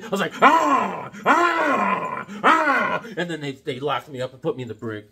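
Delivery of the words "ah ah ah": high, loud yelps of pain; mock yelps from a taser shock. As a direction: yelp like you're being tasered. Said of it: "They started tasering me".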